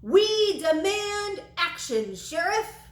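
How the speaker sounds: loud, high booming demand to the sheriff. Acted. Angry townsman booms his demand at the sheriff, loud and high. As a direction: boom it out at the sheriff, slowly. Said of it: "Fullerton K Harbor boomed".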